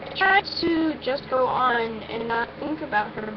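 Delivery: ordinary delivery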